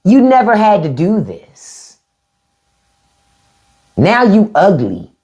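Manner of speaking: slow, scolding disbelief; disappointed scolding. Retold or imitated. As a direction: scold him slowly, let it sink in. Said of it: "and I go".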